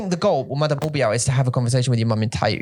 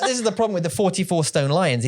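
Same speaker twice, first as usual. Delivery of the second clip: usual speaking voice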